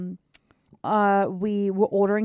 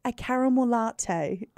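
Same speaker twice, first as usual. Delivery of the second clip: put-on slow, softer North Shore accent; 5 dB quieter, slower than usual. Friend tries ordering for the waitress, North Shore accent. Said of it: "different accent came out".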